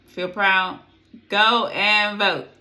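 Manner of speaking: rally them to the polls; loud rallying call. Real, not acted.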